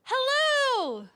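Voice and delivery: high, loud call down into the flower. Pretend. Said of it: "had to shout".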